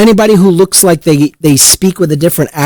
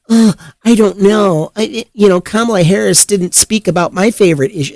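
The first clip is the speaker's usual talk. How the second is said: higher, dithering mock voice of a fussy voter; mocking whiny Democrat; 5 semitones higher than usual. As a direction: sneer at their excuses with a put-on dithering voice.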